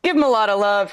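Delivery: normal talking voice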